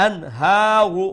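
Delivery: loud "Anharu" with the ha held long; stretched standing fatha. Shown as a demonstration. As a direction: stretch the middle vowel of "Anharu" one beat. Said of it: "one alif stretched".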